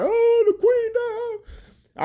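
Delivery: high, slow hammed-up sob; drawn-out mock wail; mock crying. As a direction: imitate mourners wailing over the Queen. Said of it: "they crying like".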